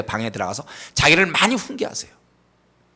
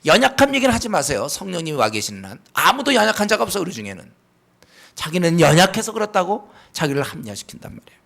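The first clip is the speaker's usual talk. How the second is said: ordinary talk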